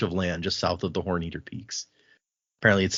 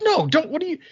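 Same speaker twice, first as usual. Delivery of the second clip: high mock panic; alarmed protest. Bar patron stopping the throat cutting, high mock alarm. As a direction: yelp it like a startled drunk. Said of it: "the guy's like".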